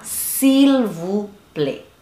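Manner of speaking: very slow, word by word; drawn-out teaching demo; slow model pronunciation. Shown as a demonstration. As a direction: stretch out each word for learners. Teacher models phrase slowly.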